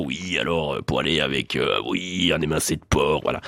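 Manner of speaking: very low monotone mock sommelier voice; serious sommelier. Sommelier advising on wine before hyper serious judges, solemn low drone. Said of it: "plein de juges qui sont hyper sérieux, puis le sommelier, il est là".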